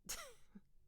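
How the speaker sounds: a quick quiet chuckle; soft laugh. Acted. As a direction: laugh under your breath. Laughs at her own question.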